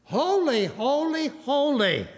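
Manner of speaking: mild, reverent calling out; crying out. Acted. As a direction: cry out each word as its own proclamation. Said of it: "They cried out".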